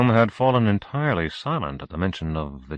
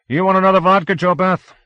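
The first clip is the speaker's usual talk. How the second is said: put-on higher, louder drunken offer; boisterous host; 12 semitones higher, 9 dB louder than usual. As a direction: push another drink on her. Mel offering drinks.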